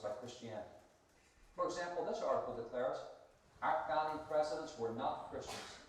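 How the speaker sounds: polished narration read; documentary narration. Acted. Reads documentary narration script.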